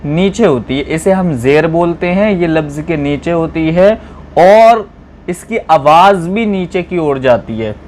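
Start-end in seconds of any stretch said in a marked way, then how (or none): none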